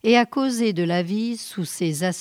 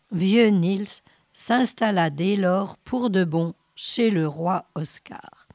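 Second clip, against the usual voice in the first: ordinary delivery, nothing marked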